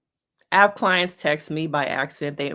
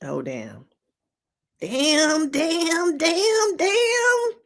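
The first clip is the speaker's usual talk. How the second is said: rising damn after damn, higher each time; mock panic; 9 semitones higher, much slower than usual. Realizing the wrong text.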